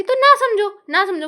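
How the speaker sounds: sudden loud, high burst of anger; angry outburst. Real, not acted. Venting to viewers about her brother, a real angry shout. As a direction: snap it out, fed up for real.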